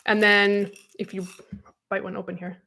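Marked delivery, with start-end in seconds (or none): none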